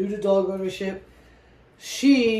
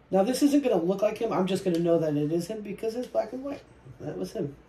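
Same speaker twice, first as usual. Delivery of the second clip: usual speaking voice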